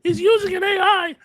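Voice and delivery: imitating people gasping that he uses AI; shocked viewers. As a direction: squawk it like a scandalized viewer. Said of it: "people are like".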